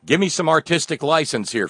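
usual speaking voice

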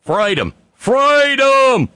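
building to a high, loud Australian yell; hammed-up rallying cry; mock protester scream.

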